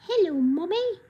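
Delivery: high mock British hello mommy; the dog greets mommy in British. Voicing the dog Gigi, high mock British. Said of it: "that's the voice she gets today".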